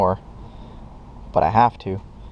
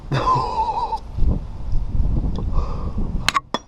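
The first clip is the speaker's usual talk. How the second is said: pained laugh. Real, not acted. Pained laugh after burning hand.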